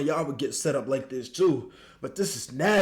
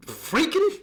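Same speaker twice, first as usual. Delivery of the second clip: incredulous; 12 semitones higher than usual. Real, not acted. Shocked at couples.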